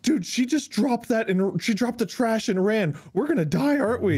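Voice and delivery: a friend retelling a buddy's panic mid-turbulence, mock panic. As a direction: act out the fear of crashing, half joking. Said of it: "and J P's like".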